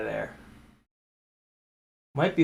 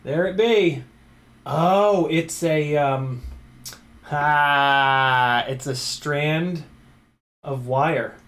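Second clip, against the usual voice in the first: triumphant; 7 dB louder, much slower than usual. Real, not acted. Triumph pulling wire.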